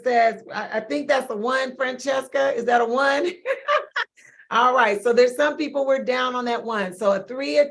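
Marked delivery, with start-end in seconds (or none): 3.4-4.0 a sudden, high real laugh; high burst of laughter. Real, not acted. Coach laughing at a chat reply before she reads on. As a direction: break into a bright laugh.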